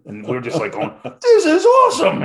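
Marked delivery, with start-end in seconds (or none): 1.2-2.2 shrieking much higher and louder with joy; ecstatic shout. Retold or imitated. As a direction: burst out like kids let loose at a party. Discovering disco full of girls.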